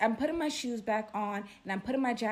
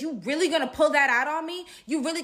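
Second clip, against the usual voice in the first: imitated indignant challenge; 6 semitones higher, 6 dB louder, faster than usual. Woman challenges man with gun.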